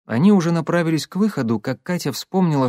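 plain reading voice

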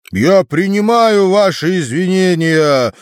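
величаво, высоким голосом; принять извинения с достоинством; благородно. A narrator's reading. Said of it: "благородно внял". (величественно)

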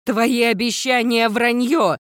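high, slowed, accusing cry; emphatic high-pitched yell; angry yell. A narrator's reading. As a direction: cry out in furious betrayal. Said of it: "закричала Виола".

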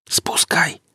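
urgent breathy whisper; a tense whispered command in the dark. Acted. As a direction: hiss the order under your breath. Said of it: "прошептал Гриф".

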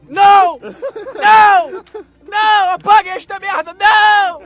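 high, very loud drawn-out protest; hammed-up screams of refusal; horrified shouting. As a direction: yell a big comic no. Mock horror at the track choice.

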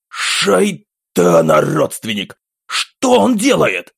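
slow, high shocked cry; shocked outcry. Acted. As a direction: cry out in disbelief. Officer shocked at monitor. (потрясённый возглас)

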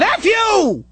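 sharp high yell; shouted retort. Retold or imitated. Driver shouting back at the parent, high. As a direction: spit it back at him.

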